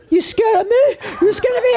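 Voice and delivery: high, loud taunting gangster voice; retold demon thug taunting the group; mocking thug voice. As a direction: sneer it like a street thug taunting. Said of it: "this other spirit was there that was a thug".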